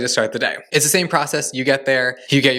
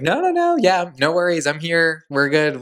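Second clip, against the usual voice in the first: far higher on the no's, then settling down; starstruck squeal; 4 semitones higher than usual. Retold or imitated. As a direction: gush at your idol, then try to stay cool. Starstruck reply to Jonathan.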